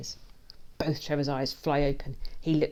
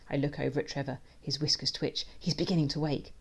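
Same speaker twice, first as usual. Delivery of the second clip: usual speaking voice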